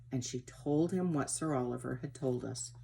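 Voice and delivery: plain reading voice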